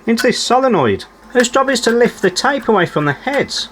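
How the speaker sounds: normal talking voice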